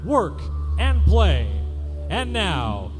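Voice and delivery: normal talking voice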